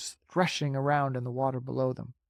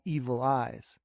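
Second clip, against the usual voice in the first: usual speaking voice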